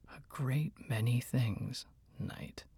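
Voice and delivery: slow, scornful whisper. A narrator's reading. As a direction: draw it out slowly in a whisper. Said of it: "in his whispering voice".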